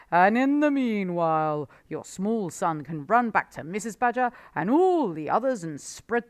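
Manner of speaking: the fox tempting Badger with chickens; sly, gloating Mister Fox. A narrator's reading. Fox boasts and instructs.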